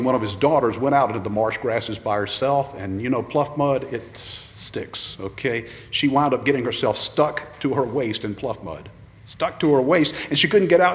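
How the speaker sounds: usual speaking voice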